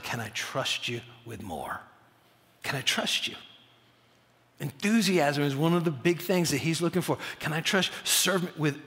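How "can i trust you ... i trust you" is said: low, soft, searching question; hushed question. Acted. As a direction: ask it quietly, as God testing a follower. God's searching question, voiced by preacher.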